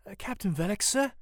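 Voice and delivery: unsure, high-pitched polite query; nervous stutter. A narrator's reading. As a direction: approach him haltingly, voice lifted. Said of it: "Samuel stutters".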